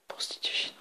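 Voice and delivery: breathy whispered word; whispered card. Acted. Reading his card in a whisper.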